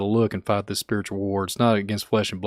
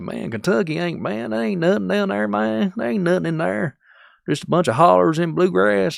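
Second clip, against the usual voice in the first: mock a Kentucky local, way up high and twangy; hillbilly Kentucky accent; 9 semitones higher, 4 dB louder than usual. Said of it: "That's how we talk".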